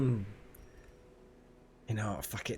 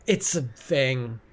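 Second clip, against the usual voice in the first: ordinary talk, nothing marked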